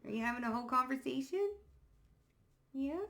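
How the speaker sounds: quick, cooing question. Real, not acted. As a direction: chat sweetly to the pet. Talking to her pet.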